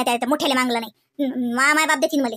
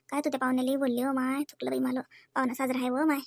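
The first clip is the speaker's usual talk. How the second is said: muttered mock apology, much softer and lower; sarcastic mumble; 3 semitones lower, 8 dB quieter than usual. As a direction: grovel sarcastically under the breath. (जावयाला टोमणा मारत, हळू आवाजात उपहासाने पुटपुटणे)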